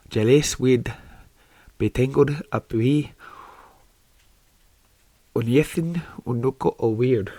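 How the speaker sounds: an American fan gamely butchering broad Scots; attempted Scottish accent. Shown as a demonstration. Reading Harry Potter aloud to YouTube viewers in a mock Scottish accent. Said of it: "a Scottish accent".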